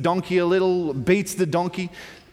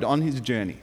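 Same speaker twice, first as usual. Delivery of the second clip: normal talking voice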